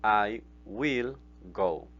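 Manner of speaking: very slow, word-by-word English; slow model sentence. Shown as a demonstration. Teacher modelling the future tense slowly for students. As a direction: lay out each word for learners. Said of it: "si yo lo digo yo iré".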